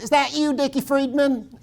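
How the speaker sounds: put-on high, loud Georgia Southern accent. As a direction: sister answering the phone with her Southern accent. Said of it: "got rid of her Southern accent".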